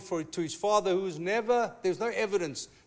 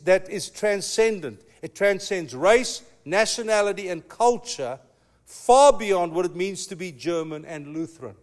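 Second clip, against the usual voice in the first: ordinary talk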